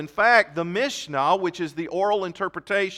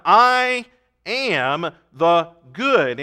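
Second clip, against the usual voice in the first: very slow, louder "I am the good", each word weighted; emphatic declaration; 6 dB louder, much slower than usual. Shown as a demonstration. Preacher declaring Jesus' I am with slow emphasis. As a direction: proclaim it slowly like a divine claim. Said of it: "he's very emphatic".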